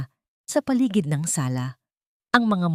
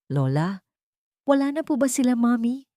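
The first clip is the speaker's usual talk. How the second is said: put-on a frightened girl asks her grandmother, pitched higher; lightly voiced scared child, rising to a higher plea; trembling child voice; 7 semitones higher than usual. Scared granddaughter asks her lola if they're gone, trembling. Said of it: "Ang kanyang boses ay nanginginig".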